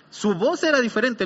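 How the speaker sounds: ordinary talk, nothing marked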